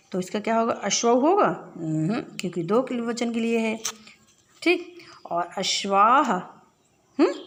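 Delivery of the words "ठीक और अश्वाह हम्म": ordinary talk, nothing marked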